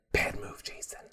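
unvoiced stage whisper aside; hushed aside. Real, not acted. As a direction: whisper the verdict to the camera. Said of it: "aside after rant".

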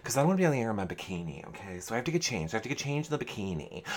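soft, fast, fussy excuse-making; hurried, hushed imitation of an evasive housemate; dismissive brush-off. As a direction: brush someone off quietly and quickly.

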